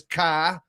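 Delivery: "car" with a long open vowel, R dropped; New England "car". Shown as a demonstration. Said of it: "unless you're from, like, New England".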